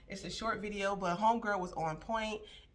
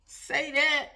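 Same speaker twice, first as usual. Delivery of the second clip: higher and louder, cheering her on; hype cheer; 7 semitones higher, 7 dB louder than usual. Real, not acted. Host hyping up the TikTok girl with a loud cheer. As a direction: shout your agreement at the screen.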